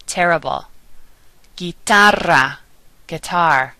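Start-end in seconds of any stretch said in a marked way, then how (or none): 1.6-2.6 slow Spanish "Guitarra", as "gee-TAH-rra"; rolled R. Shown as a demonstration. Teacher giving words to practice, rolled R in "Guitarra". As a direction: trill the RR in "Guitarra". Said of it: "the rolling R sound".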